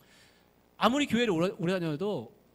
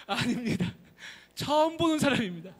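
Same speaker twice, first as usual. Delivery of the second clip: much higher, louder insisting; frantic denial; 7 semitones higher, 7 dB louder than usual. Acted. Nolbu disowning his wife, loud high protest. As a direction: shout the lie in a panic.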